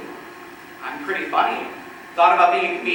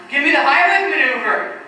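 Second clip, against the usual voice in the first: higher, mildly urgent mock plea; pitched-up imitation of a desperate request; choking victim's plea; 7 semitones higher, 4 dB louder than usual. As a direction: imitate a choking classmate begging for help. Voices the choking classmate's plea.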